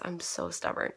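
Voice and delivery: whispered to herself. Real, not acted. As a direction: whisper it under your breath.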